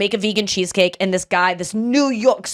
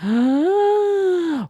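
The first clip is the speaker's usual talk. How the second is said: big mock gasp, much higher; dramatic gasp; 10 semitones higher than usual. Host reacting to a juicy Reddit post with a hammed-up gasp. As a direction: gasp like a soap star.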